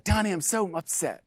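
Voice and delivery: lightly voiced upset sister-in-law; Megan impersonation. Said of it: "That's my Megan impersonation".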